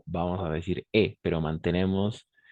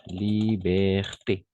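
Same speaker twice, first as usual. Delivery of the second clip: Liberté drawn out slowly, syllable by syllable; slow model word; much slower than usual. Shown as a demonstration. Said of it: "Par exemple, liberté". (Liberté muy despacio, de modelo)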